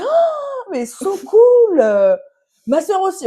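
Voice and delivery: very high, loud mock enthusiasm; imitated gushing delight. German friend reacts to her coming out, imitated squealing excitement. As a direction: squeal it out slowly, hamming up the delight.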